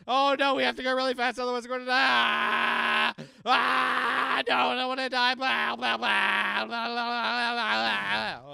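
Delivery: high loud nonstop yelling; screaming to survive. Pretend. Player keeping the scream going to survive, yelled. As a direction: scream on into babbled nonsense. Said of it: "screaming to survive".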